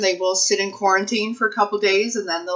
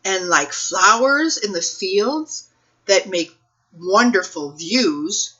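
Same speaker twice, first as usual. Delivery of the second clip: lively, sing-song picture book read-aloud, slower and higher; reading to children; 5 semitones higher, 7 dB louder, slower than usual. Acted. Librarian reading a picture book to children, expressive storytime voice. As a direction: read it like storytime for little kids, savoring each rhyme.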